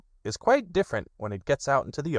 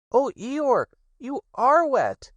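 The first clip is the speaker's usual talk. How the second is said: high, small piglet voice; high and slow little Piglet; 5 dB louder, slower than usual. Acted. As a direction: squeak it out like a tiny worried piglet. Piglet speaks in the story.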